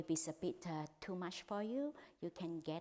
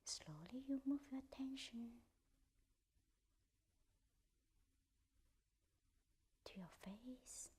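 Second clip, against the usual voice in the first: guided meditation; 2 semitones higher, 6 dB quieter than usual. Acted. Teacher guiding the group through a body scan, in a hushed whisper. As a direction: ease them through the body, part by part.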